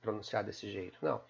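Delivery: normal talking voice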